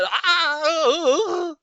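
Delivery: high, loud strangled gasping cry; choking gurgle. Acted. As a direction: splutter and choke in shock. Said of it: "A few choking sounds".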